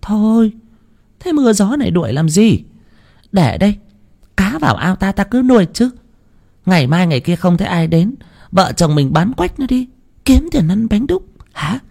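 put-on high old woman plotting to sell the dog; scheming old wife. Bà vợ tính chuyện bán chó. (giọng bà già cao, tính toán)

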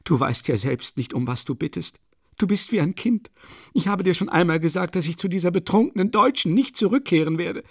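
a desperate, proud widow ranting; agitated Katharina. A narrator's reading. Narrator voices Katharina Iwanowna. (erregte, verzweifelte Mutter, höher gesprochen)

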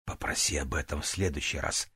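soft low whisper; quietly, almost breathed; шёпот. Acted. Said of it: "прошептала она". (тихий шёпот)

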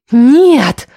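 long insistent wail of refusal; drawn out. A narrator's reading. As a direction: draw the word out emphatically. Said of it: "протянула Лиля".

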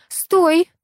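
high, loud sudden stop; halting command. A narrator's reading. Administrator checking eager Vasya, commanding halt. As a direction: call a sharp halt. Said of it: "слегка остудила его пыл".

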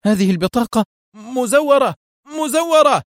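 very high, frantic cry; acted hysterical panic; panicked scream. A narrator's reading. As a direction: shriek the denial in terror, pitch soaring. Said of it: "صاح جوزيف بذعر".

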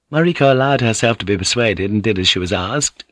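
plain reading voice

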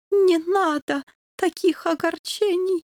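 dying king. A narrator's reading. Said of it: "вновь заговорил героический доберман".

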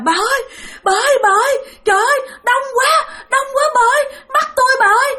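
very high, strained panicked cries; dying husband wailing in terror to his wife; terrified wailing. Retold or imitated. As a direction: wail like a dying man being seized. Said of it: "Mà ổng làm thấy ghê lắm".

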